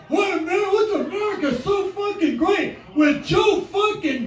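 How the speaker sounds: high, loud, aggressive stage tirade; shouted stand-up rant. Acted. Comedian ranting to crowd about America, loud and belligerent. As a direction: rant full force at the crowd, hitting every swear.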